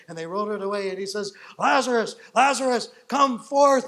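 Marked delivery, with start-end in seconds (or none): 1.6-3.9 loud, high summoning shout; calling out. Acted. Jesus calls Lazarus from the tomb, commanding shout. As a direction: call the dead man out of his tomb.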